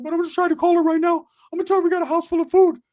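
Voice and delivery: very high, loud cartoonish squeak; squeaky [name] voice. [name] squealing back at his teasing cousin. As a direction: squeal it out like Mickey Mouse.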